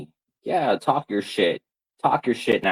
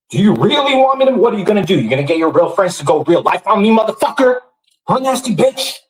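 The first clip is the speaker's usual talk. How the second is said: loud, high, fast furious taunting; angry yelling; 10 semitones higher, 6 dB louder, faster than usual. Real, not acted. Mocking rival streamer in a loud furious rant.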